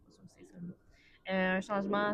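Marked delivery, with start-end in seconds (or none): 0.1-0.7 unvoiced whispered aside; whispering. Real, not acted. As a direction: whisper it to yourself. Unsure aside, word search.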